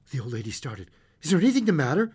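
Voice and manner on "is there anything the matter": higher and louder, breathless with sudden fear; breathless alarm. A narrator's reading. Old mother fearing news of her son, breathless and loud. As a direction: gasp it out, dreading the answer.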